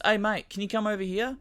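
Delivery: calling mate. Retold or imitated. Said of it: "he goes".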